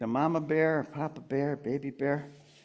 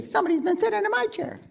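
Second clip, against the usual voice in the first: very high, quick little cub; Baby Bear; faster than usual. Shown as a demonstration. Performer feeds Baby Bear the chair line, high little voice. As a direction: squeak it fast like a small bear.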